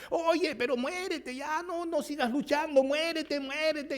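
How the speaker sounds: very high, loud taunting frog voices; jeering frogs. Acted. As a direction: shout them down to give up. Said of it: "las otras le decían".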